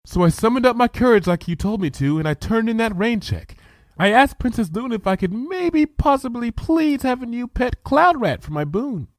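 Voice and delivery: a timid pony working up her courage; timid Fluttershy. Acted. Fluttershy retells her boon.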